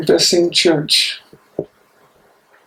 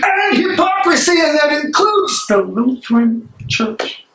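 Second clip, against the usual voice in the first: much higher, crying out in anger; outraged cry; 11 semitones higher than usual. Acted. Bonhoeffer denouncing the Lutheran Church with an outraged high cry. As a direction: fling it at the church.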